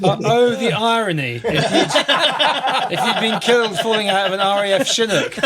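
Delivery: high, laughing through the words; can't hold back a laugh at the irony; laughing disbelief. Real, not acted. Host laughing at the pilot's near miss, amused disbelief.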